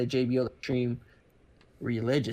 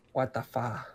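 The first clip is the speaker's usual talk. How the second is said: put-on Mexican mom accent. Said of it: "Mexican mom thing".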